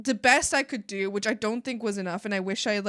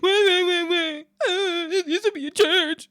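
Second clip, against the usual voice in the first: mocking whiner; 6 semitones higher, 3 dB louder than usual. Mimicking the guest about the church, mocking whine. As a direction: parrot it mockingly, stretched out and loud.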